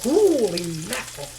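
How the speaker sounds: loud, thrilled holy mackerel; delighted exclamation. Real, not acted. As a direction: burst out in delight at the big fish. Amazed at huge mackerel.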